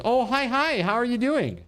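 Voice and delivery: lightly voiced sugary stranger; mock friendly bot. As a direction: mimic a fake-cheerful spam bot. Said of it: "the bot, goes".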